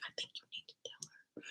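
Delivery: hushed, quick voiceless whisper; secret whisper. Retold or imitated. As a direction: nudge your cousin in a tiny whisper. Secretly urging cousin to tell.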